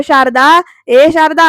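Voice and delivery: loud, slow, drawn-out call. Acted. Husband summoning his wife from another room, calling out. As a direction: stretch the name out to summon her.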